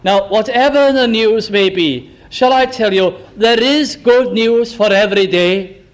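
ordinary talk

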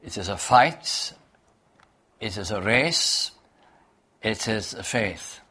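fight, race, faith each hit at the end after a pause; stress on fight, race, faith. Shown as a demonstration. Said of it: "The emphasis is on those three words".

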